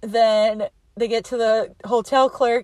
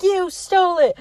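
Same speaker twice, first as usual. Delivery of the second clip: imitated heated comeback; insistent accusation; 8 semitones higher than usual. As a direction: hit YOU high, pressing the charge. Retold accusation, insisted second time.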